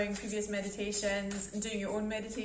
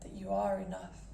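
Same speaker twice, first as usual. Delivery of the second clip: calm guided meditation voice with long pauses; 4 dB quieter than usual. Acted. Meditation host guiding listeners to breath and body, calm and measured. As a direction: ease listeners into stillness, unhurried and even.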